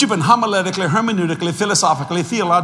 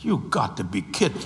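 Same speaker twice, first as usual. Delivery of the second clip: priest's shocked reply; 7 semitones lower, 6 dB quieter than usual. Retold or imitated. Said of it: "I heard this".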